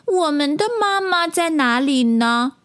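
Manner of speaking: put-on high-pitched childlike tadpole voice; little tadpole. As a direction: ask in a small child's high voice. Tadpoles wonder where mother is. (小蝌蚪稚嫩的高音)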